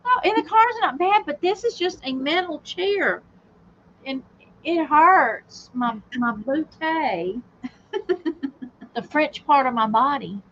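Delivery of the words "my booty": bootay, drawn out slow with a rise. Pretend. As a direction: say bootay playfully, stretched.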